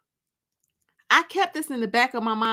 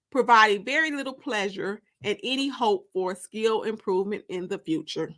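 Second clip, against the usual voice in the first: plain reading voice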